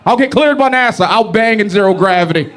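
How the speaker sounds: high, eager lust-crazed guy; hint of a desperate dude; mock horny guy. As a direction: pitch it up like a man ready to fly anywhere. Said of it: "Every man's like".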